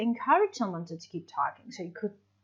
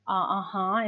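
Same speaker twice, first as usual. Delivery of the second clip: ordinary talk, nothing marked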